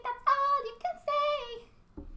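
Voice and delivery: very high, slow drawn-out squeak; Dee Dee impression slowed. Dee Dee repeating her taunt, very high and slow. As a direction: stretch the taunt in a cartoon girl voice. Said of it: "I love Dee Dee".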